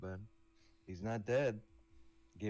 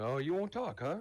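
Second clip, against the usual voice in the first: menacing taunt; 8 semitones higher, 4 dB louder than usual. Acted. Threatening a captured goblin.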